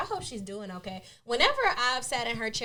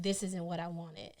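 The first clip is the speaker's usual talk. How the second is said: low, quiet, creepy voice; scary voice; 3 semitones lower, 6 dB quieter than usual. Retold or imitated. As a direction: say it low and ominous. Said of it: "in a very scary voice".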